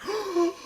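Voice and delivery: sharp high gasp of awe; excited gasp. Retold or imitated. Fan gasping as the car speeds off. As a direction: gasp like you've seen a star. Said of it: "he sees me go like that".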